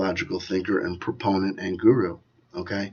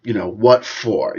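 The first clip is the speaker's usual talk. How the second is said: ordinary delivery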